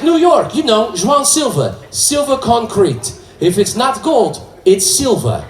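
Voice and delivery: put-on proud Portuguese accent. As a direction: sell the cousin's slogan with a thick accent. Fan pitches cousin's slogan.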